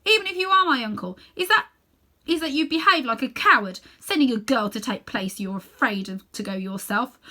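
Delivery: put-on a boy standing up to his uncle; furious young Diggory; angry boy. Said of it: "flaming with anger now".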